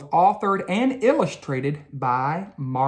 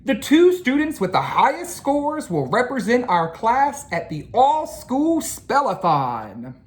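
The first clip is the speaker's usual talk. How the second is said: higher, louder teacher announcement; Ratburn announcing; 8 semitones higher, 3 dB louder than usual. A narrator's reading. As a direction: announce it to the class. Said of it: "continued Mister Ratburn".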